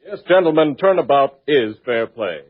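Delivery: ordinary delivery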